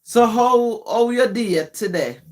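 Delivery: broad Jamaican patois; raw patois. Shown as a demonstration. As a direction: ask it in raw, broken-English patois. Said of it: "in raw patois".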